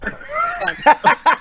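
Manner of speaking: a sudden shriek of laughter; squealing laugh; high burst of laughter. Real, not acted. Laughing at bucko.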